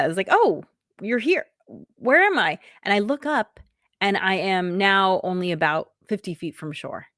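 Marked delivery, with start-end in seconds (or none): 0.3-2.6 startled. Retold or imitated. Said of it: "I'm kind of startled by it".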